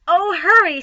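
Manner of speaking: high, loud hurry; urgent plea. Acted. Wilbur urging Templeton, high and loud. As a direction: hurry the rat, loudly.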